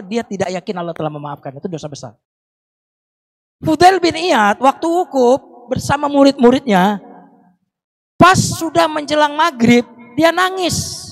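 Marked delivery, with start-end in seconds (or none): 3.6-11.1 slow, raised storyteller voice; solemn high narration; dramatic sermon storytelling. Acted. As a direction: draw the crowd into the tale.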